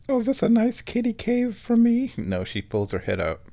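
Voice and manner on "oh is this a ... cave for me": high, cutesy kitty voice; cat's thoughts. Acted. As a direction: voice a curious cat, pitched way up. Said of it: "because she thinks".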